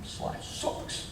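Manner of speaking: whispered, slow, under the breath; a whispered gripe about life; muttered complaint. Retold or imitated. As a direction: grumble it to yourself, barely voiced. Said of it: "You might say something like".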